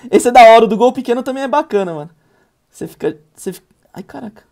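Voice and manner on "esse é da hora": excited. Real, not acted. Empolgado com o jogo.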